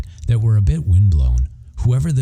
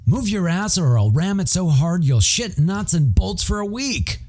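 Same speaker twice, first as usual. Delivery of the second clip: friend's mock threat; 12 semitones higher, 4 dB quieter than usual. Shane snapping at the narrator.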